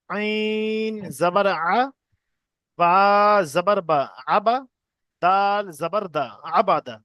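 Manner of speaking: slow drill with long-held letter names; deliberate, chant-like spelling aloud; spelling piece by piece. Shown as a demonstration. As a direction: stretch each letter, pause, then blend the syllables. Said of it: "কেটে কেটে বানান করবো".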